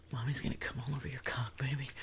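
breathy whispered taunt; seductive whisper. Acted. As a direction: whisper it close and teasing. Fast whisper.

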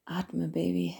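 normal talking voice

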